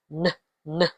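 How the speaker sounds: "n, n" as two short, soft nuh sounds. Shown as a demonstration. Teacher showing the N sound, N sound. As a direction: sound out "n" alone, separately. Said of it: "The N sound is".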